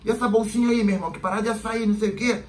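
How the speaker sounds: high, prying cop voice; suspicious cop. Retold or imitated. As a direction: poke at him about the little bag. Cop questions friend about bag.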